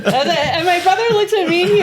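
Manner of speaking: laughing in disbelief. Real, not acted. Telling a friend the absurd ending, laughing. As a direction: giggle through the words.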